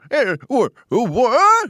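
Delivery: put-on startled high old prospector yelp; surprised old coot, loud and high. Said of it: "he'd go".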